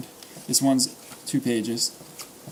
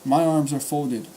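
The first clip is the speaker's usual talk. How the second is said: ordinary delivery, nothing marked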